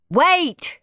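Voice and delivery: high call to stop; shout. Acted. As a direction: stop them running. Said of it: "shouted Dad".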